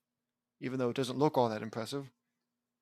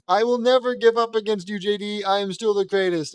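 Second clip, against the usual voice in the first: loud, raised-pitch melodramatic declaration; defiant vow; 5 semitones higher, 7 dB louder than usual. Acted. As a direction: declare it like an unbeaten rival. Acts Hunk's defiant vow.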